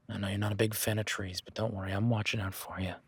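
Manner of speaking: usual speaking voice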